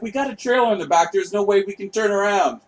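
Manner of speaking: hammed-up frustrated father shouting over the mom; exasperated dad. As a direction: bark it back like a stressed dad at the wheel. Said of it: "My dad was like".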